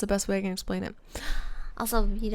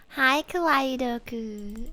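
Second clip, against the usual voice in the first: slow, sing-song higher greeting; cutesy greeting; 4 semitones higher, slower than usual. Real, not acted. Streamer cooing hello to a viewer. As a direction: coo the name sweetly.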